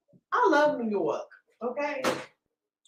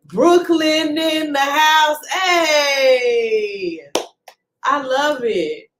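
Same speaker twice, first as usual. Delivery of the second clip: slow, loud, sing-song cheer; hype call-out; 4 semitones higher, 5 dB louder, much slower than usual. Real, not acted. Host hailing Brooklyn viewers, loud hype cheer. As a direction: holler it out, higher and stretched.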